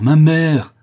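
loud, high heartbroken shout; anguished cry. A narrator's reading. Son torn by horrible scene, anguished shout. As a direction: cry out to stop them. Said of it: "s'écria Martial, déchiré".

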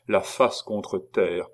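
ordinary delivery

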